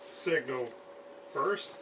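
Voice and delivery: usual speaking voice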